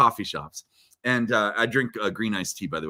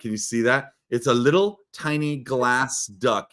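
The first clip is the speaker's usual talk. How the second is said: ordinary talk, nothing marked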